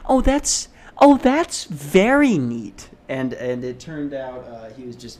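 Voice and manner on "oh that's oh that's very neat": high, slow gushing mock interest; hammed-up polite enthusiasm. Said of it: "trying to be like".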